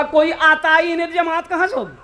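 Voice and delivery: high-pitched put-on grumble; complaining muezzin. (ऊँची आवाज़ में मुअज्जिन की शिकायत)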